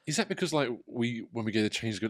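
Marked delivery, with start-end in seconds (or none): none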